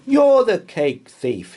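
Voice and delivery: high, loud accusation; accusing cry. Acted. Boy accuses the Roman, loud and high. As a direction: point at him and cry it out. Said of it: "he cried with a frown".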